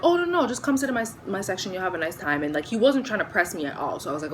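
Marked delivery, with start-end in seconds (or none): 0.0-2.4 quick, high, coaxing voice of a charming waiter; eager waiter. Retold or imitated. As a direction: lure her to his table, fast and high. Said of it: "who was like".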